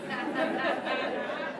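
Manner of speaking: soft, high giggle; laugh. Real, not acted. Laughing at the shadow bit, soft high giggle.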